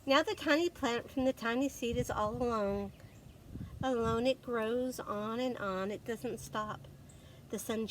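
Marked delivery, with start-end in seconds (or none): none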